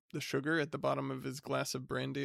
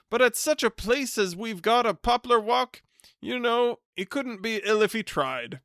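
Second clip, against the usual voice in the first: loud, high hearty greeting; top of his voice; 4 semitones higher, 7 dB louder than usual. Acted. Hearty cousin greeting the bachelor at the top of his voice. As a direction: boom it out like a jovial bore. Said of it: "spoke at the top of his voice".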